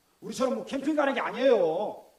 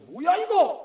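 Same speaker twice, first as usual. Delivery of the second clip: mock irritation; 6 semitones higher, 4 dB louder, much slower than usual. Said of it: "처음에 막 열받을 때가 많았어요".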